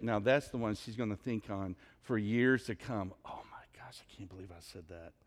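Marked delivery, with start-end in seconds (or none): 3.2-5.1 breathless whisper, fast; mortified whisper. Retold or imitated. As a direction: whisper it, cringing at yourself. Woman mortified, recalling her words.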